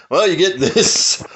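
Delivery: usual speaking voice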